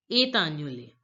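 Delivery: "Est annulé" linked as "eh-tannulé"; French liaison. Shown as a demonstration. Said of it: "link the words together this way".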